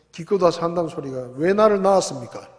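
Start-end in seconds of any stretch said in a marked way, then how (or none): 1.3-2.5 put-on loud, insolent child demanding an answer; rude child. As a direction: fling the question at the parent. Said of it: "싸가지 없는 소리를 하고". (부모에게 대드는 버릇없는 자식)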